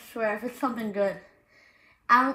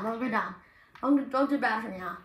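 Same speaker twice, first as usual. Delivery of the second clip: usual speaking voice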